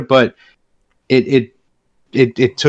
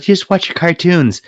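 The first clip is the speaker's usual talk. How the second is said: mother's line; 6 semitones higher, slower than usual. Retold or imitated. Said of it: "she was like".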